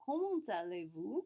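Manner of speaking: usual speaking voice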